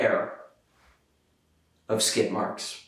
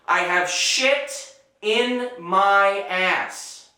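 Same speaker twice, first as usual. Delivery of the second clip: slow dramatic build; 5 semitones higher, slower than usual. Real, not acted. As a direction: drag it out, building in panic. Slow dramatic listing, rising pitch.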